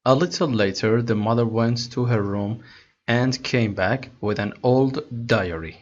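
slow, careful read-aloud of English text; slow story reading. Acted. Teacher reading the English story to students slowly. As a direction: read each word slowly for learners.

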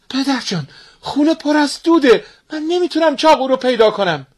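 put-on a timid girl explaining to her father; young daughter.

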